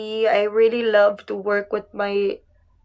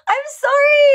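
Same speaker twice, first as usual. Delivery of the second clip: laughing apology; 14 semitones higher, 4 dB louder than usual. Real, not acted. Laughing apology after mixing up places.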